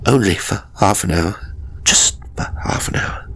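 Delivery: hushed, pleading, trailing into a whisper; subdued near-whisper plea; low subdued pleading. A narrator's reading. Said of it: "in a voice low and subdued, almost a whisper".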